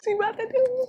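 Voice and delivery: high, frantic squeal of dread; panicked inner thought. Retold or imitated. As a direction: squeak it out like you're about to get caught. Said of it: "like, thinking".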